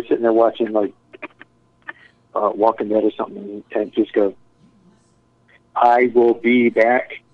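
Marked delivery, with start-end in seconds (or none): 5.8-7.2 very slow, word by word; voice-to-text dictation. Retold or imitated. Friend dictating a text during TV, slow deliberate delivery. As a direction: imitate dictating to a phone, spacing each word. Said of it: "with the voice-to-text".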